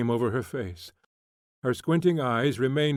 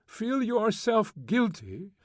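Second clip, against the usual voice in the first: mocking his words, much higher and slow; angry mimicry; 10 semitones higher, slower than usual. A narrator's reading. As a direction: throw his phrase back in scorn. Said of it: "angrily mimicking him".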